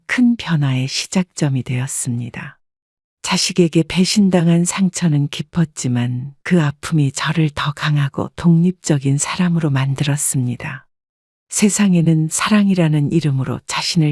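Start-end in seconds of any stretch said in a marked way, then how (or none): none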